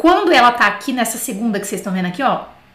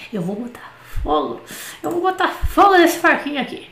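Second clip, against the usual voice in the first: away from mic; 4 semitones higher than usual. Real, not acted. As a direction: voice set back from the mic. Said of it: "deixa eu afastar esse microfone aqui". (longe do microfone)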